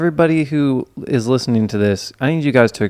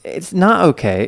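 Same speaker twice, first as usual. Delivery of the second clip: mock outrage; 6 semitones higher, 3 dB louder than usual.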